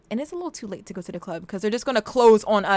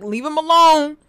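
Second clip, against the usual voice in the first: louder, stern step-in; a touch of a brother stepping in; mock protective; 6 semitones higher, 7 dB louder than usual. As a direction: brother tells her to back off. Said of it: "He's like".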